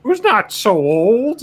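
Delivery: high, slow teasing drawl; mock reveal. Narrating the not-so-old man, high and slow. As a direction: stretch it out for the joke.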